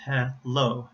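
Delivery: "hello" as two pieces, he, llo; split into syllables. Shown as a demonstration. Teacher shows syllable starts, "hello" split before L. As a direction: break "hello" before the L, leaving the first vowel open. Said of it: "the second syllable starts with the consonant".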